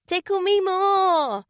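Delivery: high, slow playful plea; little girl begging her aunt to tickle her; pleading child. Acted. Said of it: "pleaded Naomi".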